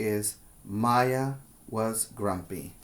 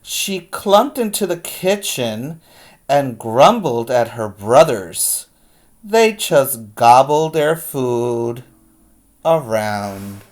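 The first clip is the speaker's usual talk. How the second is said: put-on lively read-aloud to kids; picture-book reading voice; story narration; 9 dB louder than usual. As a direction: read it like storytime, big and expressive.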